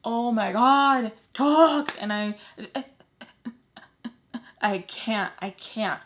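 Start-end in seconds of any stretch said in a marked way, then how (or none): 0.0-2.0 loud, high, desperate urging; re-enacted frantic yell; screaming inside. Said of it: "I'm screaming". 2.6-4.4 halting, choked false starts; re-enacted stammer with gaps; can't get it out. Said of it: "I can't get it out".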